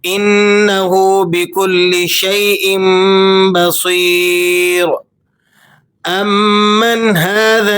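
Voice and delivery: measured, melodic tajweed recitation; Quran recitation. Acted. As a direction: recite the verse slowly and melodically. (تجوید کے ساتھ تلاوت)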